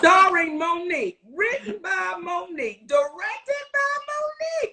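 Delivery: rising hype with each credit; playful self-hype, pitch climbing; excited. Real, not acted. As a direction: announce every credit bigger. Hyping her new project.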